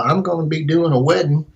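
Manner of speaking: imitating a friendly Tennessee guy; Tennessee accent. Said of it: "This is my best Tennessee accent".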